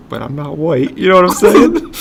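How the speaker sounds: high, laughing punchline; cracking up. Real, not acted. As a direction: land the joke while breaking into laughter. Jokes on lyric, cracking up.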